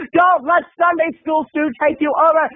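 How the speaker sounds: hammed-up inner pep talk; mock frantic self-warning. Moviegoer fighting rising anger, mock urgent self-talk.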